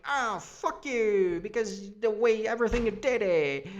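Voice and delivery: a son snapping at his mother, mock angry; mock snap. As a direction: bite back at her in a mock angry snap. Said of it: "Kurt is the one to snap to her".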